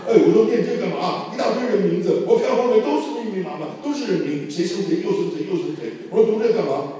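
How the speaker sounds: high, loud retold grumbling; exasperated complaint. Student grumbling at a long list of names, exasperated. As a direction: replay a baffled reader's impatient protest.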